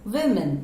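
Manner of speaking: Women as "wim-in"; wimmin. Shown as a demonstration. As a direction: model Women with a short i in the first syllable. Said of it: "कैसे बोलोगे?".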